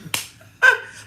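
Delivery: short shrieking laugh; a quick high burst of laughter; real laugh. Real, not acted. Laughs after point.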